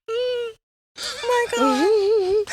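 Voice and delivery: very slow, high crying voice; emotional tears. Real, not acted. Host to the couple, moved to tears by their love story. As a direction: choke up, laughing through tears. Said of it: "I'm sorry, tears".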